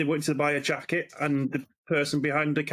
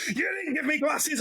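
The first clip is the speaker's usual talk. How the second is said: retold angry customer; 9 semitones higher than usual. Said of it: "she came running around".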